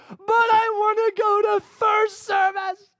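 put-on high, loud, slow whiny child voice; crying child. Daughter to dad after tears start, high whiny wail. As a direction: wail it like a teary nine-year-old. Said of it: "tears start rolling".

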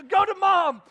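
loud, high, reenacted yell; angry shouted order. Dad sending older daughter to mom, angry shout. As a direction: bellow a no-argument command.

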